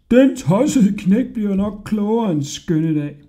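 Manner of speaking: high, loud jeering porters; mocking shout. A narrator's reading. Porters jeering at departing Hodja, loud mocking call. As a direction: call after him, mocking. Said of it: "mens de råbte".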